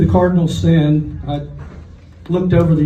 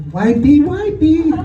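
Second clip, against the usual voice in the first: high, slow, louder sing-song wipey wipey; mock attendant call; 10 semitones higher, 4 dB louder, slower than usual. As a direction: chirp it like a silly bathroom attendant. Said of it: "bang on the stall and go".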